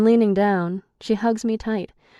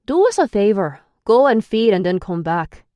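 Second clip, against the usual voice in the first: loud Irish command; Declan giving orders; 3 semitones higher, 8 dB louder than usual. A narrator's reading. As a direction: bark the order at Ian. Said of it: "Declan orders Ian".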